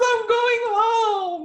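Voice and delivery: very high, loud, drawn-out joyful wail; happy bawling. Retold or imitated. Dying mother telling her daughter, joyful bawling. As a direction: wail it slowly, overcome with happiness. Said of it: "she's so happy she's going home".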